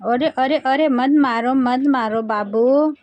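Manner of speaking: cooed mock protest, drawn out at the end; lilting mock plea to a little one; playful baby-talk pleading. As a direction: plead playfully with a baby to stop, stretching the last words. Mother pleading playfully with hitting baby.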